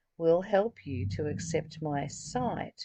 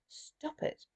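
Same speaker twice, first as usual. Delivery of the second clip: softer, whispered mock scold; 7 dB quieter than usual. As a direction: tell yourself off in a playful whisper. Playful self-scolding, then laughs.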